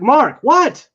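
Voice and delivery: pressing him to notice; mock insistent wife; urgent. Lisa urging him to look.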